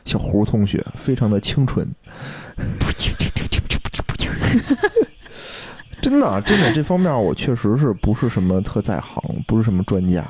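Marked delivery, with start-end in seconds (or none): 2.2-5.0 breathy high-pitched giggle; high airy giggle. Real, not acted. Giggle after teasing.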